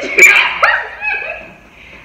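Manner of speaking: loud high shriek of laughter. Real, not acted. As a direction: scream with laughter. Bursts out laughing at the story.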